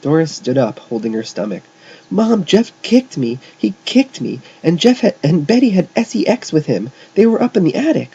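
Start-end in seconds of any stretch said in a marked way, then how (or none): 2.1-8.1 high, loud whining tattle; tattling child. A narrator's reading. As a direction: tell on them loudly to mother. Girl tattles to mother after kick.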